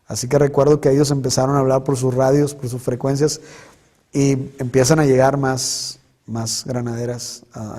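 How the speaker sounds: ordinary delivery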